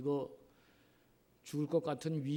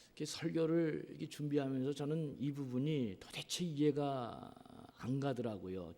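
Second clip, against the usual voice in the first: usual speaking voice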